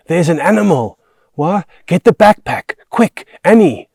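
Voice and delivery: high, loud panicked yelling; urgent shouting. A narrator's reading. Children facing a wild animal, shouting in alarm. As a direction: shout as the beast closes in.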